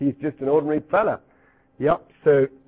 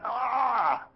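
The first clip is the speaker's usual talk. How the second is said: mock cry; 8 semitones higher, 6 dB quieter than usual. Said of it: "When they cry out".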